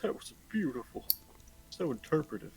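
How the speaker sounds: a hint of a gruff moved stranger. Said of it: "you hear a gruff voice saying".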